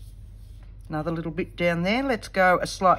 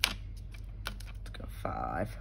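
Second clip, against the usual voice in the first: slow unvoiced mutter, much lower; whispered mutter; 12 dB quieter, much slower than usual. Real, not acted. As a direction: breathe it to yourself slowly. Muttering to herself while stamping.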